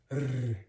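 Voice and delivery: "r" as a long tongue trill; rolled R. Shown as a demonstration. Teacher contrasting two R's with one tap, "r" rolled long. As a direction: roll the "r" strongly and hold it. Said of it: "You roll".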